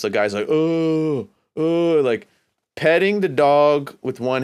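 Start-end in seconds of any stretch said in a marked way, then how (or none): 0.5-2.0 higher, drawn-out "oh" imitating the man; imitated moaning sounds. Storyteller mimicking the guy petting the dog, higher moaning sounds. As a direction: mimic his held moans. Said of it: "he's, like, making sounds".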